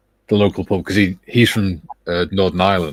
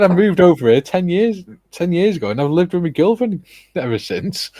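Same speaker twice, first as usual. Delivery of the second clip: high-pitched, aggrieved pleading of his case; retold indignant protest; 9 semitones higher than usual. Neighbour defending himself to pub staff, retold indignation. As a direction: imitate a man protesting at unfair treatment. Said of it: "he was raging".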